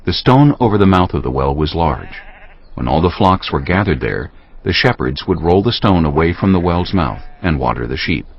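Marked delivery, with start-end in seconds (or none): none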